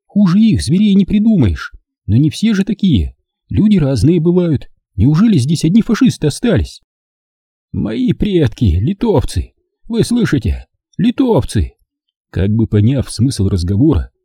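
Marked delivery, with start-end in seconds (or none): 0.1-6.8 put-on higher, earnest young woman's voice; Zina's voice. A narrator's reading. Zina argues with comrades, higher earnest voice. 7.7-11.7 higher, tearful insistence. A narrator's reading. Old man insists to partisans, mildly tearful. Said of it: "слезливо твердил старик".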